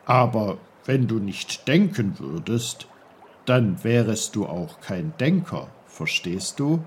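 lightly voiced patient old uncle; low, patient old uncle. Uncle explains to Peter.

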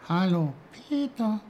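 high, tiny snowdrop voice; little high-pitched greeting; small quiet voice. A narrator's reading. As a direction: speak up as a tiny flower from the ground. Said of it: "sagte eine leise Stimme".

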